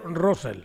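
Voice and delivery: "Russell" as RRO-sel, said softly; Spanish pronunciation. Shown as a demonstration. Choosing Spanish for a colleague's name, "Russell" said RRO-sel. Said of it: "quiero hablar en español".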